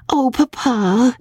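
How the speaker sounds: put-on high, drawn-out girlish complaint; impatient daughter, slow and high; impatient exclamation. A narrator's reading. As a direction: whine at Papa impatiently. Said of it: "Magnolia exclaimed impatiently".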